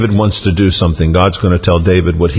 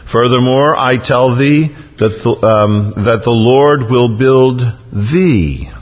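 raised, deliberate recitation that falls away; scripture reading; 3 semitones higher, slower than usual. Acted. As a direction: draw out the promise, pause before the last words. Reads climax of God's promise.

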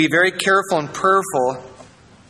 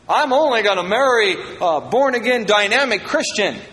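higher-pitched imitation of a single's bold vow; mock resolute vow; 7 semitones higher than usual. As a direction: declare it with naive conviction. Voicing a single person's firm vow.

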